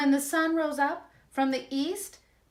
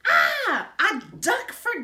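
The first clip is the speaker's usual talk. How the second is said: louder, higher delighted father; pleased father; 10 semitones higher, 6 dB louder than usual. Acted. The father gloats over the duck caught for dinner, mildly pleased. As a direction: welcome dinner with glee. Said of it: "said the boy's father".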